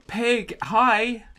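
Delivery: high squeal of delight; excited squeal. Real, not acted. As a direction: squeal at the cute pig. Excited at spotting a pig.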